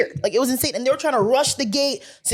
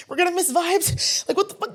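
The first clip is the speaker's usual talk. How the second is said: mock panic; 6 semitones higher than usual. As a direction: imitate friends panicking, voice pitched up. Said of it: "my friends are, like, panicking".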